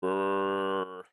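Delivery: low growling zombie groan; Minecraft zombie voice. Pretend. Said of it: "Minecraft zombie voice impression".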